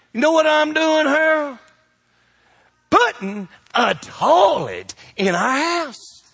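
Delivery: exasperated wife. Retold or imitated. Wife confronting him at midnight.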